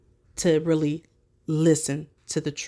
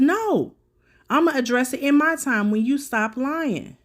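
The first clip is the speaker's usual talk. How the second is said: higher, louder mock yell of her answer; mock-yelling the reply, pitched up; retold scream; 7 semitones higher, 4 dB louder than usual. Commentator voicing the woman's screamed reply, mock yell. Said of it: "She screams".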